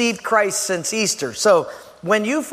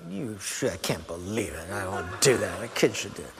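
very low, hushed grumble; muttered grumbling; 11 semitones lower, 10 dB quieter than usual. Acted. Spouse told to take out rubbish, muttering resentfully. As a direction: mutter a mock complaint under the breath. Voicing grumbled reply to chore.

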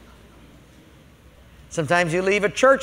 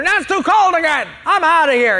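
high, louder huff; fed-up customer; 8 semitones higher, 5 dB louder than usual. Retold or imitated. Customer quitting the restaurant in a hammed-up huff, high and loud. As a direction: storm off in a mock huff.

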